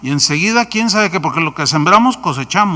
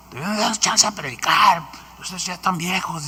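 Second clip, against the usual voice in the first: mock arrogant young man, softer; sneering at the old, half-hearted; 5 dB quieter than usual. Young preacher mocking the elders, sneering. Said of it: "Pero así, ofensivo".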